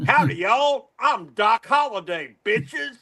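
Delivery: Texan accent, thrown out as a brash one-up; Texan accent done right. Shown as a demonstration. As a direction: correct their Texan with cocky, high-pitched gusto. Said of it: "this is how it should be".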